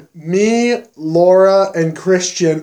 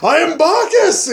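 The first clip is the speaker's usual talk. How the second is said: put-on much higher, grand drunken declaration; mock proclamation; 11 semitones higher than usual. Drunk friend proclaiming himself the wine god, hammed-up. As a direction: announce yourself as a god.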